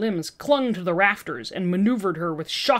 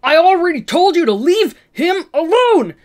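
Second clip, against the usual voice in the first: furious roar, much higher and louder; 10 semitones higher, 10 dB louder than usual. Acted. Peter three protecting young Peter, furious roar. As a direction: roar it with full fury. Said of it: "Peter three roared".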